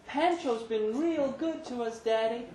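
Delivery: sweet little daughter; little girl's voice. A narrator's reading. Daughter Laylene greeting her dad, small and high.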